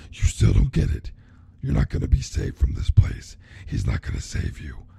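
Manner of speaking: breathy, then very low menacing whisper; whispered villain threat. Acted. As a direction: threaten her in a dark hiss. Freddy taunting the trapped girl.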